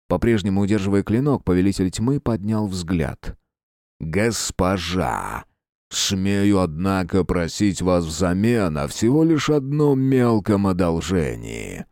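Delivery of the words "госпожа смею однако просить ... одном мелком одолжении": slow menacing villain rumble; dark lord. Acted. Dark lord asks favour.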